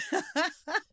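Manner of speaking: high, real laughing fit; helpless laughter. Real, not acted. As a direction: crack up at your own story. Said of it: "It is so funny".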